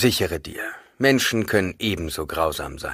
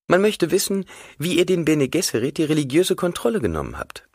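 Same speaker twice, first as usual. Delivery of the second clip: higher, polite probing question; Hwi's voice; 10 semitones higher than usual. Acted. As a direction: voice Hwi asking carefully. Hwi asks Leto.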